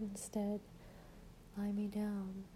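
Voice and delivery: ordinary talk, nothing marked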